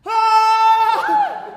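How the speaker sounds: loud, high squeal of delight; delighted scream. Acted. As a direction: squeal with joy. Amazed by the gift.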